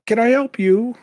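high, loud put-on greeting; Burt Reynolds. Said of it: "And, and he walks out".

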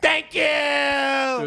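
high, stretched dismissive call; sing-song it high and pointed; bachelorette party passenger. Acted. Bachelorette dismissing the terrorist, petulant.

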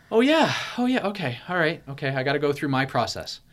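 psyching up. Retold or imitated. Psyching himself up.

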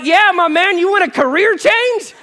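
high, loud playful pitch; joking offer. Retold or imitated. Pastor joking back to stranger.

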